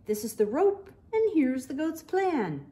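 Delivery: lively, animated bedtime story reading; picture book read-aloud with voices; reading to children. A narrator's reading. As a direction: read it bright and playful for little kids.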